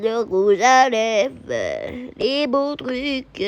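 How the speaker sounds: slow drawn-out oohs over the makeup; hushed delighted marvelling; awed admiration. Acted. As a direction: savour each treasure you find.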